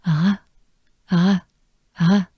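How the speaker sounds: "uh" as an exhaled French R; breathed French R. Shown as a demonstration. Teacher showing the R is basically a breath, exhaled French R. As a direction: exhale "uh" with the tongue kept down. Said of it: "it sounds like this".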